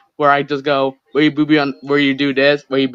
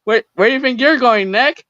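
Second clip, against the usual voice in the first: higher, sing-out mock taunt; playful pursuer; 5 semitones higher than usual. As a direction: call after a fleeing friend, pitched up.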